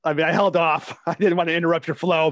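normal talking voice

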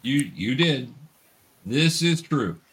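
ordinary talk

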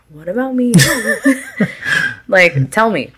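high, laughing mock question; laughing mock plea. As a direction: ask it with a giggle. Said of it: "where I'm just like".

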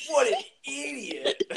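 high, slow scoffing quote; Chaz Reinhold. Acted. Chaz scoffing at a hang gliding accident, high drawn-out voice. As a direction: stretch it out in a high mocking voice. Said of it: "And Chaz goes".

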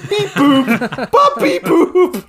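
high, slow beeping robot. Retold or imitated. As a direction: beep like a malfunctioning robot. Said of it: "when the robot is in his death throes".

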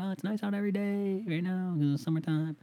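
high exasperated mock whine; annoyed boyfriend. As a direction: roll your eyes in a high put-on voice. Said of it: "I'm like".

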